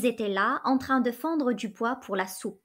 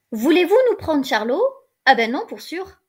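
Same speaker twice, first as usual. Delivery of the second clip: louder, sharp peasant no; indignant refusal; 4 semitones higher, 7 dB louder than usual. A narrator's reading. Peasant mother refusing to give up Charlot, loud and indignant. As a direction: push back hard at the very idea.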